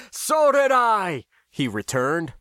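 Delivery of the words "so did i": loud, high, drawn-out elated cry; thrilled, laughing along. A narrator's reading. Pap returns Max's call amid the laughter, thrilled and loud. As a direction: shout it back with relieved delight. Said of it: "laughing with the rest, looking relieved and thrilled".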